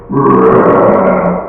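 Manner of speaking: loud, rolling growl; devilish baby growl. Retold or imitated. Dad imitating what he heard from the bassinet, demonic growl. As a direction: imitate a monstrous rumbling growl. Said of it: "and I hear".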